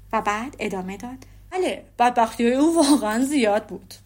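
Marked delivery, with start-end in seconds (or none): 1.5-3.9 او said with a falling, mocking weight; dry sneer of fake sympathy; sarcastic agreement. A narrator's reading. Darcy mockingly agrees about Wickham's misfortunes. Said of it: "با لحن تحقیرآمیزی تکرار کرد".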